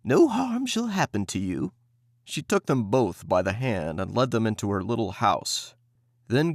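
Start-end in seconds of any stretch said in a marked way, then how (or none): 0.0-1.7 put-on high, coaxing old woman voice; sweet old woman. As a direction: lure them in with false kindness. Witch luring children kindly.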